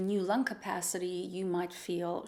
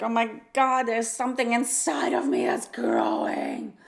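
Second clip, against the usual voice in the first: loud, freaked-out exclamation, retold; retold panic; 3 semitones higher, 7 dB louder than usual. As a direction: replay the panic, half-laughing at herself. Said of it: "the first instinct was like".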